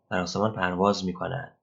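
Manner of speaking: ordinary talk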